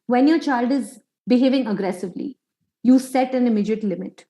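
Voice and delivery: usual speaking voice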